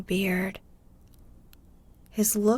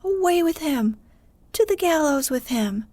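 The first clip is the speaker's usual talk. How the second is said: crowd shouting; 6 semitones higher, 4 dB louder than usual. A narrator's reading. Angry crowd calls for hanging.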